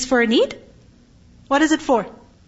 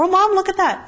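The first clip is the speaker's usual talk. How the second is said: pitched-up fake "look over there" call; mock surprise; 7 semitones higher than usual. Child distracting mom to sneak food, pitched-up mock surprise. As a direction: sound suddenly amazed to distract her.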